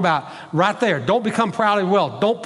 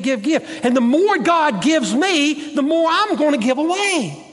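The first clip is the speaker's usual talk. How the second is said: higher-pitched, building pulpit exhortation; impassioned preaching; 6 semitones higher than usual. Real, not acted. Preacher urging congregation to give rather than get, impassioned. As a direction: preach it up high, driving each repeated phrase.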